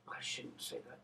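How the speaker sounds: breathy whisper, self-censoring; whispered aside. Real, not acted. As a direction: mutter it off-mic like a confession. Whispered aside after a joke.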